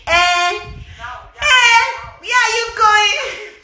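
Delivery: high, slow mock call of a local guy; mock local toaster. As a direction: imitate a village man calling out to a woman. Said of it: "You say he can't tell you say".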